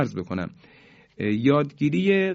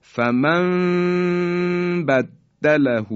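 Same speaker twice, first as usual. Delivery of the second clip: فمن بدله chanted slowly and higher as famam baddalahu; Quran recitation; 6 semitones higher, 3 dB louder, much slower than usual. Shown as a demonstration. Teacher modelling the recited phrase. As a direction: intone فمن بدله with held nasal mim.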